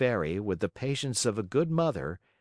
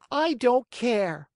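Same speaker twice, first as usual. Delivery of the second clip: high, loud, slow defiant whine; stubborn child; 5 dB louder, slower than usual. Acted. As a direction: drag out the defiance, shouting. Tantrum continues.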